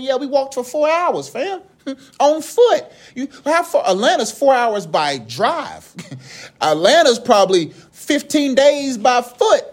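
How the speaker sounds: high, drawn-out mock protest; comic incredulous complaint. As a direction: stretch out the distances, half laughing. Acts out weary travellers' comic complaint.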